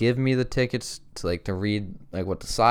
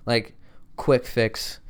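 ordinary talk, nothing marked

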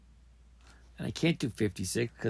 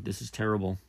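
usual speaking voice

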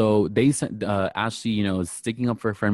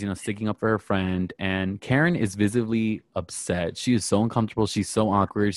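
ordinary talk, nothing marked

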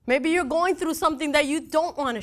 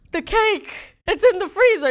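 high, louder, alarmed cry; panicked realization; 6 semitones higher, 6 dB louder than usual. Retold or imitated. Wife remembering forgotten anniversary, alarmed high cry. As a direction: blurt it out in sudden dismay. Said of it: "and I'm like".

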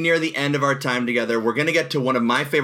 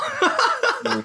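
high delighted laugh. Real, not acted. Delighted after the freestyle.

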